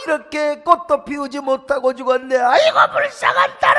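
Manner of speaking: high, loud theatrical wailing; mock lament. Preacher imitating hired mourners lamenting, high, loud wail. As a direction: wail over the dead girl.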